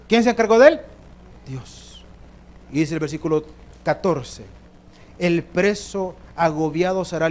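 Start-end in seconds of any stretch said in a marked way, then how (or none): 1.5-2.1 breathy low Dios; preacher answering his own question; whispered answer. Acted.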